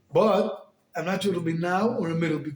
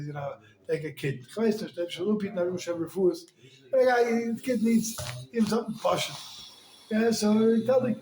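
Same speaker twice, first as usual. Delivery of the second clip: usual speaking voice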